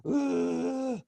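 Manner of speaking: high thin mock infant wail; drawn-out high imitation cry; imitated baby crying. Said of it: "let her cry it out".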